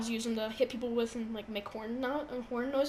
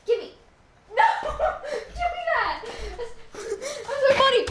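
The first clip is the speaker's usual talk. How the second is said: pleading protest; 8 semitones higher than usual. Real, not acted. Yelling at brother who grabbed the gift card, high pleading. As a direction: chase him for the card.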